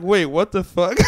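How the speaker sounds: high, loud, incredulous outburst; disbelieving, excited double-take; retold shock. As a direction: re-enact being floored by the news. His retold shock at coincidence.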